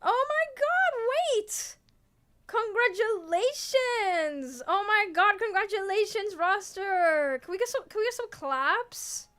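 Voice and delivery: high excited exclaims; excited congratulations. Real, not acted. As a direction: cheer the chat on. Sudden congratulations to chat.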